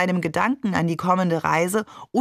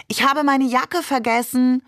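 higher, lightly raised calling voice; mild loud call; 6 semitones higher than usual. A narrator's reading. Friend calls back so the parents in the living room hear, mild loud call. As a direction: call it out for others to overhear. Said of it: "rief sie laut".

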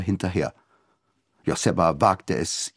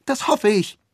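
defiant daughter; 14 semitones higher than usual. A narrator's reading. Daughter defies father.

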